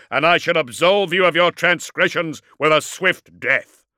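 booming challenge. A narrator's reading. Dreadnought challenges his cornered enemy to come out, booming. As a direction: thunder out a demand for surrender. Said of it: "he boomed".

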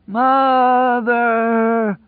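very high long drawn-out cry; high mad drawn-out call; crazed call. Acted. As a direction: wail it out at length. Prisoner keeps calling for mother.

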